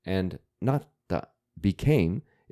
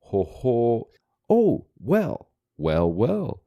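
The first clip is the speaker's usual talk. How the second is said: impressed exclamation; 4 dB louder, slower than usual. Shown as a demonstration. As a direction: sound intrigued and pleased, like a knowing elder. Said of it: "an exclamation often used when someone is impressed".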